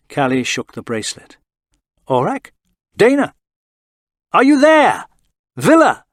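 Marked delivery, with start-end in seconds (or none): 2.1-6.0 calling out. Acted. Calling into a dead communicator.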